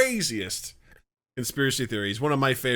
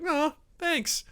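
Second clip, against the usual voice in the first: retold light reply; 14 semitones higher, 5 dB quieter than usual. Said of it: "it's just like".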